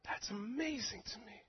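breathy whisper of wonder; a private whisper to the congregation; whispered awe. Real, not acted. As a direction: hush it, voiceless and amazed. Repeated line, second whispered.

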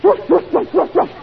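loud mock dog barks; punchline yapped as a fake dog; cat barking like a dog. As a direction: bark it out like a dog to scare the mouse. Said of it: "And the cat says".

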